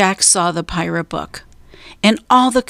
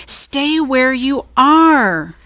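high, slow, drawn-out call; shouting; 5 semitones higher, slower than usual. A narrator's reading. Jack warns Annie on the ladder, drawn-out shout. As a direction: shout a warning, stretching the words. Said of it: "Jack shouted".

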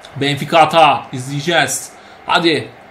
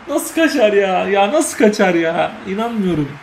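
laughing disbelief. Real, not acted. Teammate misses a header.

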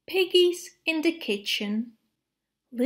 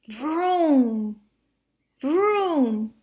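car noise; 2 semitones higher, 4 dB louder than usual. Acted. Car engine sound in story.

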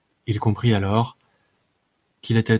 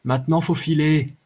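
higher, urging a companion on; raised-pitch call to get going; 6 semitones higher than usual. A narrator's reading. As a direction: toss it out as a prompt to leave. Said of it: "lança Dugam".

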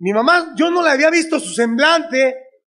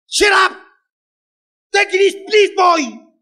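mock English; 5 semitones higher, slower than usual. Mocking mother in English.

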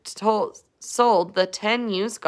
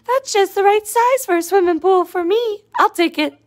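tiny worm voice; 11 semitones higher, 4 dB louder than usual. Acted.

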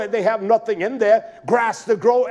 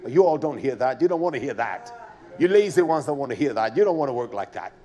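low scolding; 8 semitones lower, 3 dB quieter than usual. Real, not acted. Scolding lazy congregation, low.

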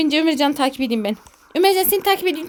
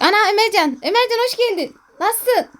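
high, excited greeting; delighted surprise; 6 semitones higher than usual. Real, not acted. Player spots his friend Ömercan in game, excited high welcome.

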